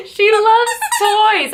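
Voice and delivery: high, slow, delighted; high gushing pet voice. Real, not acted. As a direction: gush over the dog. Gushing over dog.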